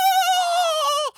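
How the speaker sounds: long, very high, loud "oh" wail; hammed-up creature climax shriek; mock orgasm scream. Mimics creature's orgasm as wings sprout.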